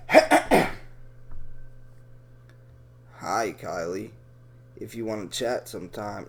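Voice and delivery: very low, soft creepy voice; Paul Westwood voice. Retold or imitated. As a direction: drop way down and creep it out. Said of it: "can I do a Paul Westwood voice".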